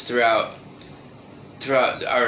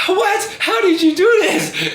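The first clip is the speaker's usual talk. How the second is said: high, loud mock squeal; hammed-up shriek of disbelief; surprised auntie; 4 dB louder than usual. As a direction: imitate an aunt aghast, laugh creeping in. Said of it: "like, surprised".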